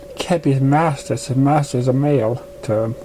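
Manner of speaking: ordinary delivery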